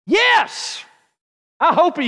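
high, loud burst; defiant shout. Acted. Preacher answers timid believers, shouting defiantly. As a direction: fire back a loud yes.

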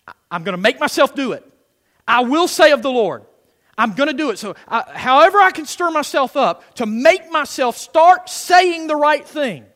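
loud, rising, determined declaration; fired-up preaching. Real, not acted. Preacher stirring himself up to say it, fired-up preaching.

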